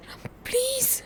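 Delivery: hushed breathy plea, begging him softly; pleading whisper. Acted. Pleading whisper after quarrel. (கிசுகிசுப்பான கெஞ்சல்)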